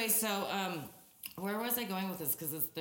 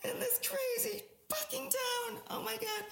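very high mock-panicked wail; hammed-up high-pitched dismay; retold panic. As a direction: reenact freaking out, voice shooting way up. Retelling her panicked reaction to lawyer.

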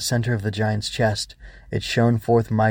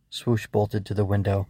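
ordinary delivery

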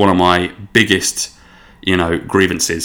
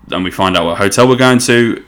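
ordinary talk, nothing marked

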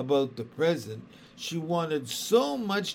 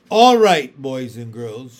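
sharp raised voice; 2 semitones lower, 14 dB louder than usual. Acted. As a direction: snap the class to attention. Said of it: "a voice that was no longer soft".

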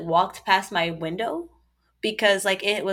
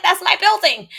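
high, louder retold outcry; raised-pitch insistence, acted out; alarmed protest; 11 semitones higher, 5 dB louder than usual. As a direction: protest urgently to the man blocking you. Retold protest at being stopped.

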